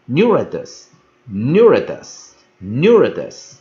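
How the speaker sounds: neuritis said slowly and loudly, three times; pronunciation demo. Shown as a demonstration. Teacher introduces the next fun word, pronunciation demo. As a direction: model "neuritis" loudly, each one falling away. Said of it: "下一个单字就好玩了".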